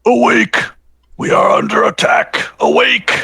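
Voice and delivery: loud, high shouted warning; alarm shout. Acted. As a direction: yell to wake the whole house. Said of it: "I sound my alarm".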